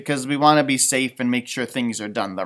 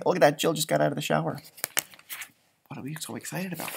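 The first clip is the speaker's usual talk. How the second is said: ordinary delivery, nothing marked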